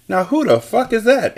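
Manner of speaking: high-pitched, annoyed demand; mildly acted irritation at an interruption; irritated question. A narrator's reading. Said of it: "Annalise asked".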